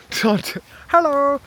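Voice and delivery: very high, loud mock Scandinavian hello; imitated local accent. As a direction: chirp it in a put-on Scandinavian accent. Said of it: "I love the bloody accent".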